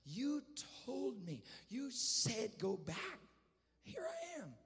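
high, drawn-out imitation of a sobbing prayer; pleading, high retold cry; acted weeping plea. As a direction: wail it to God in broken pieces. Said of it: "I'm just weeping and sobbing and crying".

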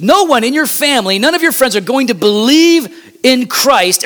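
high, emphatic preacher's delivery; full-force preaching. Real, not acted. Preacher warns church nobody can be argued into faith, forceful preaching. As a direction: preach it high and insistent, driving every point.

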